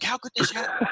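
high, whiny panicked friend; upset friend. Retold or imitated. Friend calling about lost picks, high whiny disbelief. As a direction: play the friend who lost his bets. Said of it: "He's like".

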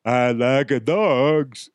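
slow, drawn-out fake Arkansas accent; bad Arkansas accent. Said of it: "that's a really bad Arkansas accent".

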